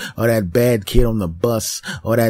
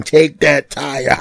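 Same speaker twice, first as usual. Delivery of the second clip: slow, louder gloat; 5 semitones higher, 6 dB louder, much slower than usual. Retold or imitated. Chopping up the tire, slow loud gloat. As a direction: draw it out with glee.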